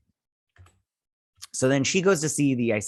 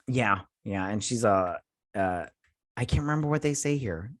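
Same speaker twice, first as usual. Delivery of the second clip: ordinary talk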